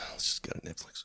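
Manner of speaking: unvoiced, resigned whisper; whispered aside. To himself, giving up on the discs, whispered. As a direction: breathe it out like giving up. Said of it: "and I'm like".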